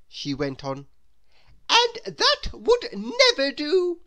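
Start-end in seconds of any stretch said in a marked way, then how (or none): 1.7-4.0 very high, loud, almost wailing indignation; a much higher, louder melodramatic whine; snobbish Miss Splendid. Acted. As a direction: overplay the horror of buses. Miss Splendid scorns the bus.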